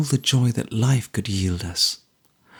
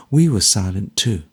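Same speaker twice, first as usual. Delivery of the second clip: plain reading voice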